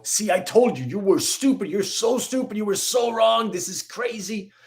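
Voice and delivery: high, mocking told-you-so jeer; gloating mock taunt. The other person taunting him over the candle, mocking and high. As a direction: sneer it like a smug know-it-all. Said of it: "Would I like them to go".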